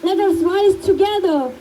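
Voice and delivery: measured, emphatic public address with long pauses; solemn tribute speech. Real, not acted. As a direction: deliver a heartfelt eulogy as a rallying speech. Delivers a written tribute speech.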